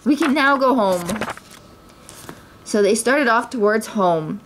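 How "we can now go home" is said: high, excited victory announcement; triumphant, high-pitched glee; jubilant cry. A narrator's reading. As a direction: crow about the win in a raised pitch. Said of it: "he cried".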